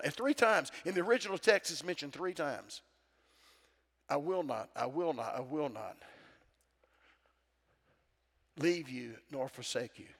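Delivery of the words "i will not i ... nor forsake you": softer and lower, a gentle vow; soft tender promise. Acted. Preacher softly voicing God's promise to the church.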